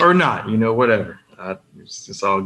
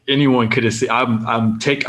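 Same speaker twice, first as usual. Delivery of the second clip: usual speaking voice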